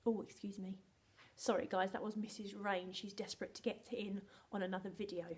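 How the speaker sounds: normal talking voice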